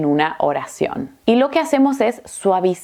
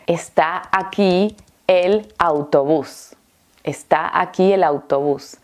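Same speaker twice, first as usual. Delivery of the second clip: réponse articulée. Shown as a demonstration. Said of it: "la respuesta es".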